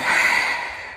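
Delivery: real heavy sigh. Real, not acted. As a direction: let out a long breath of emotion. Emotional speaker pauses mid-thought.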